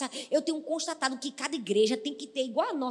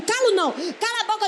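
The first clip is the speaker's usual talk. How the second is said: high, loud refusal to be silenced; defiant shouted reply; loud defiance; 8 semitones higher, 6 dB louder than usual. Acted. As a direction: snap back defiantly. Defiant back-and-forth quote.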